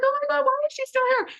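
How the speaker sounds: high, fast, shocked squeal; shocked disbelief. Retold or imitated. To shelter staff, seeing the dog unadopted, a shocked high squeal. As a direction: blurt it out in sudden disbelief.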